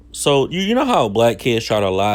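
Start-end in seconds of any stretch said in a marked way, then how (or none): none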